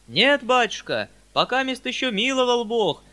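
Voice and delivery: high, loud peasant voice; talkative peasant. A narrator's reading. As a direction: answer the master as a garrulous peasant. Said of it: "отвечал один из мужиков, вероятно, большой говорун". (голос мужика-говоруна, высокий и громкий)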